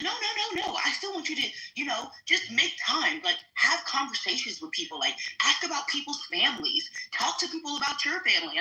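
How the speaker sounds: imitating an awkward, placating supervisor; placating backpedal. Retold sergeant backpedalling to her.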